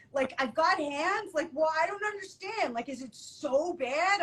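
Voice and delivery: high, pleading imitation of the friend; whiny friend. Telling a friend how he whined about being single, high and pleading. Friend complaining, voiced in story.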